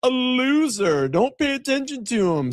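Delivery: high, slow, drawn-out Valley Girl mockery; mocking a rival in a Valley Girl voice. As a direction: stretch every word like a snooty Valley Girl. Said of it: "Sounds like a Valley Girl".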